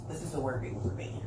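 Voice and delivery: low whisper, done with him; whispered brush-off. Retold or imitated. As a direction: breathe it out, no voice at all. Retelling breaking it off with partner.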